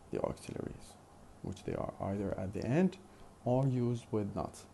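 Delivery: normal talking voice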